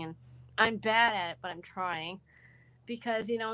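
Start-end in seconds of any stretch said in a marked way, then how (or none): none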